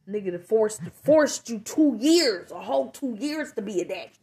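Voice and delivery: furious rant. Retold or imitated. Telling the ex off about court, rising furious yelling. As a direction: tear into him about the two-year fight.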